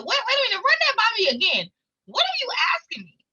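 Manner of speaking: can't believe what you just heard; stunned disbelief. Retold or imitated. Stunned by the settlement offer.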